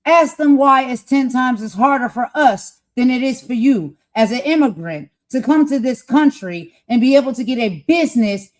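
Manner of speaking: loud, insistent demand; getting loud. Real, not acted. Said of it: "I'm getting loud".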